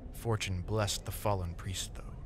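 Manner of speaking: usual speaking voice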